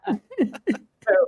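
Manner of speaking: ordinary talk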